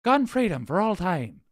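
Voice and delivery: hammed-up Irish guy; Irish accent. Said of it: "Fine, he's Irish".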